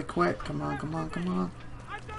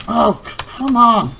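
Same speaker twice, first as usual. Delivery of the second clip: loud, raised exasperated cry; frustrated shout; 6 semitones higher, 6 dB louder, much slower than usual. Real, not acted. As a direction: burst out at the game, loud and high. Frustrated at failing again.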